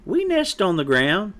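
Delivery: high, matter-of-fact chick; contradicting young chick. Acted. Chick points out mother's own nest, cheekily. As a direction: point it out with a lifted tune.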